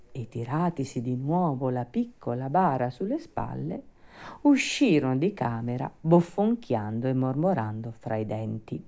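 ordinary delivery

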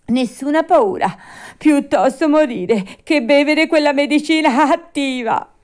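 high, loud tantrum voice; Pinocchio, defiant. Acted. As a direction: declare it with childish bravado. Puppet prefers dying to medicine.

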